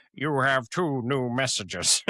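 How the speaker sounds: slow robotic announcer; flat recorded-machine read; answering machine voice. Retold or imitated. Teller imitates the answering machine, slow robotic read. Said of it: "it says".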